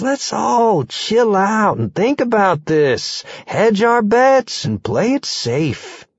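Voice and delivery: slow, soothing motherly voice; level-headed mom voice; calm mother. Acted. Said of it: "the mother usually says".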